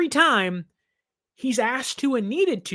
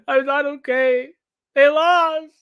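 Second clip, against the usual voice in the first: hammed-up heartbroken fan, louder on they lost; mock despair; 5 semitones higher, 6 dB louder than usual. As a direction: overplay the devastation. Upset fan answering his fiancée.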